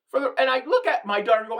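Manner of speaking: ordinary talk, nothing marked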